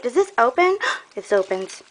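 ordinary talk, nothing marked

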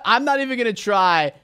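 ordinary talk

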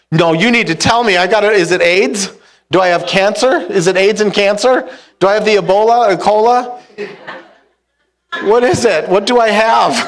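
rising panic, begging the doctor for the diagnosis; panicked pleading. Retold or imitated. To the doctor on the phone, escalating panic.

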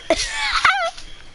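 high-pitched cackle of a laugh. Real, not acted. Player gloats over kicking someone, high laugh.

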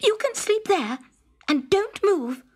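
high, curt princess order; bossy princess. A narrator's reading. Princess ordering the frog onto the sofa.

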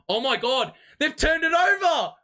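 sudden loud, high cry of disbelief; excited shout. Real, not acted. Fan yelling at a turnover on stream. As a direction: yell it out as the ball is lost.

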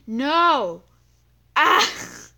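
high, loud gamer scream; real panicked shriek. Real, not acted. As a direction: yell out in panic. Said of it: "All you hear is this kid screaming, which is me".

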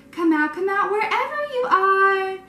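shouting. Acted. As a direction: shout it like hide-and-seek. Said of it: "they shouted".

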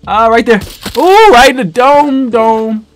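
high, loud cheer over the headshot; gleeful gamer shout; triumphant cheer. Real, not acted. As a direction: crow with delight. Landing a headshot.

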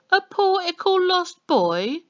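put-on high, loud, slow sing-song baby voice; mock baby talk. A narrator's reading. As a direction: coo it mockingly like to a toddler. Policeman mocks in baby talk.

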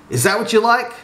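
high-pitched jeering yell; taunting shout. A narrator's reading. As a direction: shout it as a mocking challenge. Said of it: "shouted Willard".

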